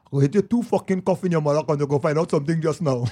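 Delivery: offended Trinidadian dad; country Trinidadian accent. Retold or imitated. Imitating his offended dad, country Trinidadian accent. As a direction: scold in a rural Trinidad accent. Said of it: "so his shit is like this".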